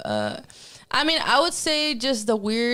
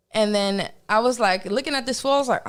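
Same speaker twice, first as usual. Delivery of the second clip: ordinary talk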